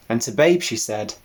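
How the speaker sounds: plain reading voice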